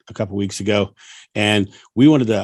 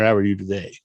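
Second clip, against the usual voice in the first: ordinary talk, nothing marked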